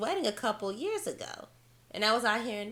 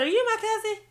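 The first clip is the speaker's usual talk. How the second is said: high, loud retold shock; incredulous question; 14 semitones higher, 5 dB louder than usual. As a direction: squeak out a stunned question, mock-dramatic. Retells asking the man, stunned.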